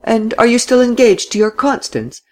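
high, teasing question; mock him lightly about his fiancée; touch of mockery. A narrator's reading. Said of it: "with a touch of mockery this time".

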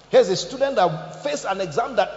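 usual speaking voice

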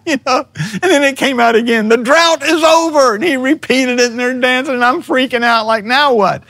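high-pitched telling through laughter; amused, squeaky-high disbelief; laughing retelling. Real, not acted. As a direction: laugh helplessly at your own panic. Laughing as he retells his panic.

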